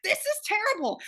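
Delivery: high-pitched retold dismay; mock appalled. Viewer reacting to people dumped over their accents, appalled. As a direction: cry out in exaggerated dismay. Acted outraged reaction to the show.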